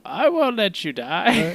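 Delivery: high, slow, sultry siren voice. Retold or imitated. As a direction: purr it like a siren under the waves. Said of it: "sultry voice under the waves go".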